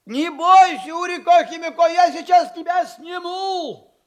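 put-on high, loud call to a frightened child; reassuring shout. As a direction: holler comfort up the tree, drawing out the end. Дед кричит девочке на дерево. (ободряющий громкий крик вверх)

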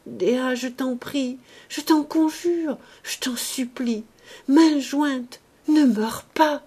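put-on frantic young man begging at a deathbed; desperate pleading. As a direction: beg her with everything you have. Gwynplaine pleads at dying Dea.

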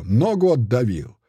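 usual speaking voice

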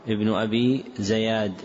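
زياد said "Zayad"; fatha on zay. Shown as a demonstration. Teacher repeating the misprinted name, زياد with fatha. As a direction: put a short "a" after the z in زياد. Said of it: "فوقع بفتح الزاي".

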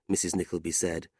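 ordinary delivery

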